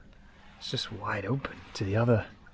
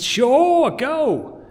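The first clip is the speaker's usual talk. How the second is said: much higher, louder bright go-ahead; eager agreement; 9 dB louder than usual. Retold or imitated. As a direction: blurt out an over-eager yes. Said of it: "I said".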